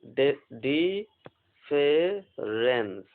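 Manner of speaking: very slow, drawn-out word for dictation. Shown as a demonstration. Teacher dictating new word to students, very slowly. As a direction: stretch the word out for writing. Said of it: "देखिए".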